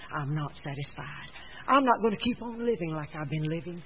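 low, soft, grave resolve; hushed, earnest sermon delivery; preach. Real, not acted. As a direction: drop to a quiet, solemn declaration.

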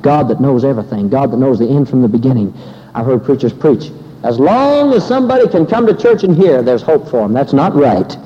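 loud, driving sermon delivery; fervent preaching. Acted. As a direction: preach it at full force to the congregation.

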